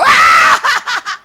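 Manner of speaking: long, loud, high wail; mock exasperated scream. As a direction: scream out in pretend frustration. Wife's outburst at bad photo.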